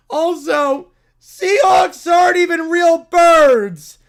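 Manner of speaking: loud shouted whine, slowing down; bratty rant. Acted. Brat ranting about fake birds, loud whiny shout. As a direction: shout it like a kid who didn't get his way.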